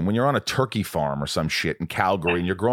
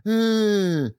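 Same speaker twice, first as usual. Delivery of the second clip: high, doubtful hum then word; mock skeptical self; 11 semitones higher, much slower than usual. Singer doubting the coach's advice, mock skeptical. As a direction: sound unconvinced. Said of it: "And I'm like".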